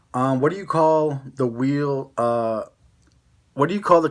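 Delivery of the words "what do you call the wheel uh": ordinary talk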